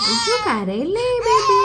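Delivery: high, drawn-out pleading coo; slow, loud play-soothing over the crying; cooing to crying baby. Pretend. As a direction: coax a crying doll in a high voice. Hushing the crying baby doll.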